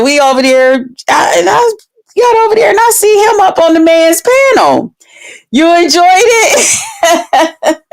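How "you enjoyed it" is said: slow, high, laughing question. Real, not acted. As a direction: laugh through the words. Laughs while reading comment.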